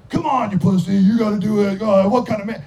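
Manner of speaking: loud, goading drinking buddy; mock peer pressure. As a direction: egg him on loudly.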